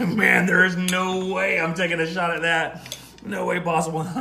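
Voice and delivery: laughing refusal. Real, not acted. Refusing Mexican accent request.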